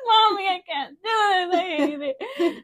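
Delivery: hammed-up crying to mom in the car; mock crying whine. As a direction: whimper it like a crying kid, laughing through. And I'm just crying.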